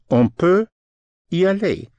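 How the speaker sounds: careful demo. Shown as a demonstration. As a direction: lift On peut up high, then land on y aller. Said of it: "Peut, spelled P U T".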